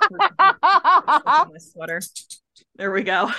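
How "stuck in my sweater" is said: slow, high laughing words. Real, not acted. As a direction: laugh through it, drawn out. Dog stuck in sweater, laughing.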